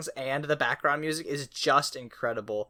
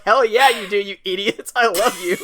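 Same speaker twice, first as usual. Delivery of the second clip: higher, much louder delighted cheering; gleeful cheer; 7 semitones higher, 11 dB louder than usual. Retold or imitated. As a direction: cheer them on with mock-insulting love. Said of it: "I was like".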